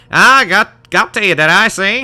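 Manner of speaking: high, loud attempt at Scottish; wandering Scottish accent. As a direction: stumble through a Scottish brogue. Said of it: "This accent's gonna go all over".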